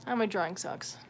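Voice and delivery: ordinary delivery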